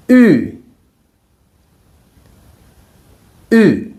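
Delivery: "U" said as Mandarin ü with rounded lips and tongue forward, through the tones; ü vowel drill. Shown as a demonstration. Teacher models the two-dot ü for students to repeat. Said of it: "practice with the letter U with the two dots".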